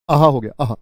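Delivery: खुशी. Shown as a demonstration. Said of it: "खुशी का भाव पैदा करते हैं वो होते हैं आहा".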